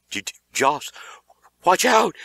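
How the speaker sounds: stammering, scared and rising to a cry; a scared kid warning his friend; frightened whimper. A narrator's reading. Beaver warns Josh, stammering in fear. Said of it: "he whimpered".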